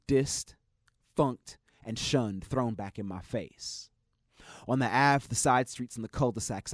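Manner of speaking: rhythmic slam poem delivery; spoken word poetry. Acted.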